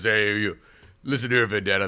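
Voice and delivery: loud, blustering tough talk; tough-guy bandmate impression. Mimicking the lead guitarist's monstrous ego, loud tough talk. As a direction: imitate a bandmate's big-headed tough talk. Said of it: "he talks like this, you understand?".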